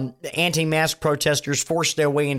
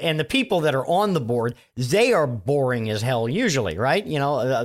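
ordinary delivery

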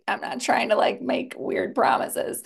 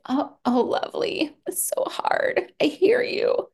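soothing friend; 7 semitones higher than usual. Retold or imitated. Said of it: "like, you would say".

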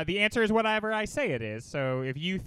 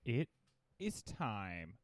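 game show announcer; 7 semitones higher, much slower than usual. Acted. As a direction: build slowly to a sung-out TRIVIA. Announces the trivia segment.

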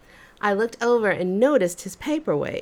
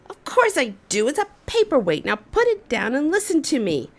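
curt, raised voice, brushing her off; impatient shouting boss; 6 semitones higher, faster than usual. Retold or imitated. Irritated boss shouting at the employee to listen. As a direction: snap it out higher and fast. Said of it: "He just shouted at me".

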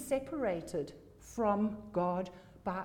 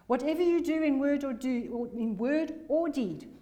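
louder correction; 4 semitones higher, 5 dB louder than usual. Real, not acted.

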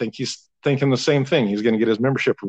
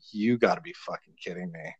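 inner voice; 6 semitones lower, 9 dB quieter than usual. Retold or imitated. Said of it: "inside I'm going".

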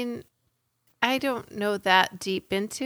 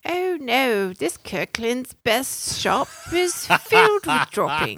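mock British; 2 semitones higher, 3 dB louder, slower than usual. Mock British shop line.

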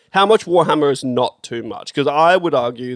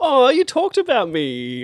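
high, drawn-out mock coo; mock-touched gushing; 10 semitones higher, slower than usual. Co-host teasing friend who mentioned them, mock-touched gushing. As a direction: gush slowly as if flattered.

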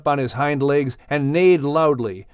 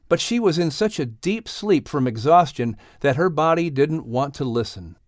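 plain reading voice

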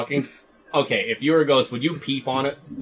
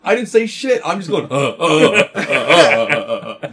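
mock flustered denial; 6 dB louder, slower than usual. A husband denying the moaning, flustered stammer. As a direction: stammer out the denial, laughing through it.

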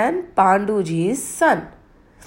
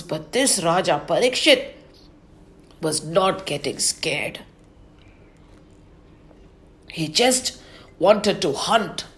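plain reading voice

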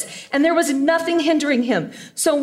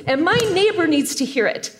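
normal talking voice

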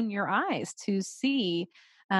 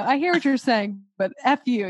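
mock defiance; 4 semitones higher, 7 dB louder than usual. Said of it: "she was like".

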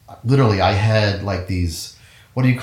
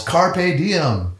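high-pitched mock yell; retold yell; 8 semitones higher than usual. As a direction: imitate a teacher's rallying cry, held back.

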